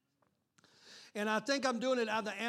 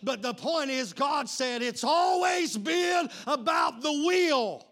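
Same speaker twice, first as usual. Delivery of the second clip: loud, raised pulpit voice; fired-up preaching; 4 semitones higher, 7 dB louder than usual. Real, not acted. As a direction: exuberant preacher building in force. Preaching at full force.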